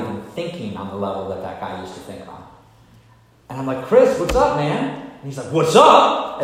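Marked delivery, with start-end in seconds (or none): 3.9-5.0 high, loud friendly call; a big cheerful hello; cheery greeting. Retold or imitated. Greeting old bully at bar. 5.5-6.4 high, loud aggressive what's up; a bar tough squaring up; challenging shout. Retold or imitated. Bully's hostile reply.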